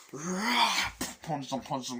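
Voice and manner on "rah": high, loud imitated war cry; imitated roar. Nicolas Cage's character attacking the man, roaring. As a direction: roar as you lunge in. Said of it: "Nick Cage is just like".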